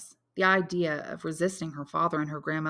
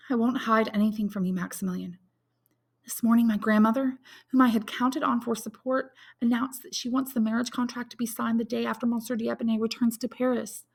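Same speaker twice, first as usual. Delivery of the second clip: put-on higher, distressed young woman's voice; young woman Valentine; 7 semitones higher, 3 dB louder than usual. A narrator's reading. Valentine confides her bad news to Maximilian, anxious and higher.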